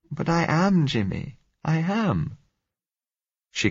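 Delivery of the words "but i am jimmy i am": high, pleading protest of fear; frightened young woman. A narrator's reading. Frightened girl answers don't be afraid.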